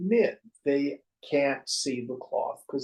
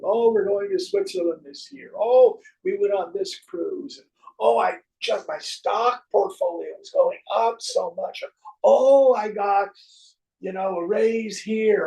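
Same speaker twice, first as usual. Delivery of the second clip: higher, louder bragging chatter; boasting friends; 7 semitones higher, 8 dB louder than usual. Retold or imitated. Friends bragging about trips, mock boastful. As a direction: show off to your friends. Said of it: "talk to our friends".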